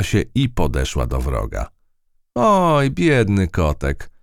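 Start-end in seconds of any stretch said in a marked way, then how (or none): 2.3-4.1 a high "Oj", then biedny kotek slow and cooing; the mouse teasing the stuck cat with fake pity; mock sympathy. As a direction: pity him, but don't mean it. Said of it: "z udawanym współczuciem".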